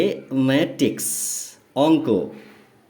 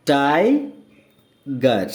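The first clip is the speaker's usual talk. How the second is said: Tiger extremely slow, in two separate parts; drawn-out rising "tai", long pause, falling "gar" in tiger; broken into syllables; much slower than usual. Shown as a demonstration. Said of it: "দেখো এখানে ব্র্যাকেটে দেওয়া হয়েছে".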